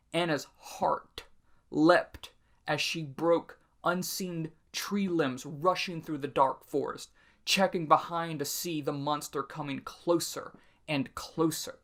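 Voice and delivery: slowed-down telling of Anna's flight; slow dramatic reading. Shown as a demonstration. Teacher reading the scary rewrite slowly, with suspense. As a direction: draw out the chase slowly for suspense. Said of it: "could be rewritten as".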